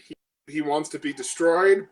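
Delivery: ordinary talk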